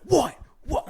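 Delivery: loud high "what, what" over a beaten guy; taunting shout. Retold or imitated. Dude taunting the guy he knocked down, loud. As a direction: stand over him and bark it out.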